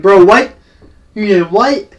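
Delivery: loud, high-pitched disbelieving cry; a stunned, loud bro what; shocked disbelief. Retold or imitated. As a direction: blurt it out in total shock. Shocked reaction to friend's confession.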